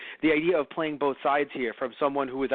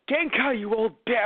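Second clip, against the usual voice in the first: rude Yusuke insult; 7 semitones higher than usual. Retold or imitated. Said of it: "it's just like".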